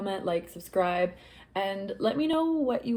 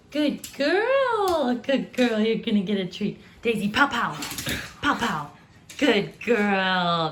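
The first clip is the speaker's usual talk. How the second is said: dog praise; 5 dB louder, slower than usual. Real, not acted. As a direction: gush over the dog.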